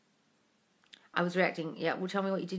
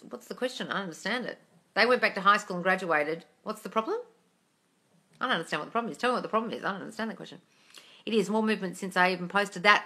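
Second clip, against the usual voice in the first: ordinary delivery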